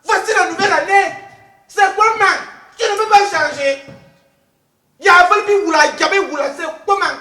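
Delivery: loud, high-pitched telling-off; exasperated scolding. Acted. Host scolding Sebago over long-winded names, loud and exasperated. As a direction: cut in loudly, fed up with your co-host.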